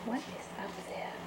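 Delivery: breathy curious whisper; whispered kitten voice. Pretend. Kitten peeking out, whispering its question. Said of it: "He goes".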